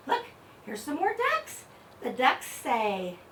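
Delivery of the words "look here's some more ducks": sing-song delight. Acted. Showing toys to child.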